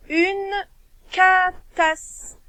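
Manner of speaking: "catastrophe" stretched out slowly, syllable by syllable; teacher dictating the last word; slow dictation. Shown as a demonstration. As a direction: dictate it slowly for young writers. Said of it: "Dernier mot".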